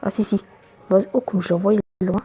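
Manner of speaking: ordinary talk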